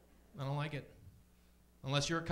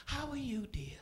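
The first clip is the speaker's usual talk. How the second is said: put-on high whispered old lady; a lightly voiced old aunt; 10 semitones higher, 3 dB quieter, slower than usual. Imagining his dead aunt greeting him, high whisper.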